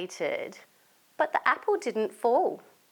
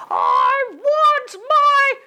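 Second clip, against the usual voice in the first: furious shout; 11 semitones higher, 9 dB louder than usual. Acted. Marvin demands his apple back, furious tantrum scream. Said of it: "He shouted again".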